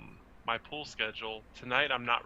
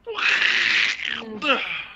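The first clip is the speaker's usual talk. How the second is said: duck squawk; 8 semitones higher, 6 dB louder than usual. Pretend. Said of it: "That was a great Donald Duck, uh, voice".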